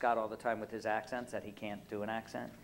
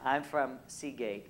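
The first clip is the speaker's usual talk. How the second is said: ordinary talk, nothing marked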